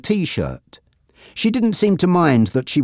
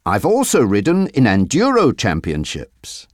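usual speaking voice

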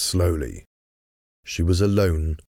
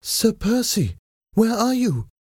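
high-pitched, eager calling out; excited call. A narrator's reading. Wife calling for her husband in the dark, excited. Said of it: "she called, excited".

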